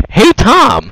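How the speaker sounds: mock call-out. Mocking friend's blunder.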